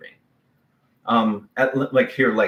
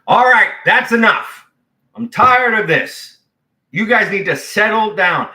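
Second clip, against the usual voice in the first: much higher and louder, firm and fed up; stern command; 11 semitones higher, 9 dB louder than usual. Retold or imitated. Jesus rebuking the storm, loud fed-up command. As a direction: shut it down like an exasperated parent. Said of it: "it's almost like he got up and said".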